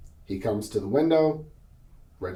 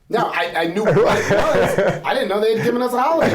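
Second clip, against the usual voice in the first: higher and louder, laughing through the words; 8 semitones higher, 6 dB louder than usual. Real, not acted. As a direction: laugh as you admit it, fast at the end. Laughing about the Juneteenth holiday.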